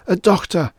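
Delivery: high, loud desperate cry; gasped plea. A narrator's reading. Groversb begging an official for a doctor, panicked cry. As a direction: gasp it out in panic. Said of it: "he gasped".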